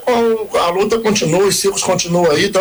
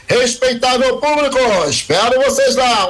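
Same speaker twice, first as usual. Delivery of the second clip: ringmaster; 9 semitones higher than usual. Acted. Clown's closing circus invitation.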